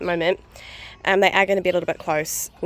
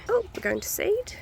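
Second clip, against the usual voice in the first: surprised; 13 semitones higher than usual. Real, not acted. Surprised at seeding herbs.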